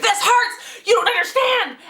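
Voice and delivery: high-pitched mock tantrum; angry, in pain. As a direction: imitate an angry, hurting dad wailing at the kids. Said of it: "he's getting angry at us".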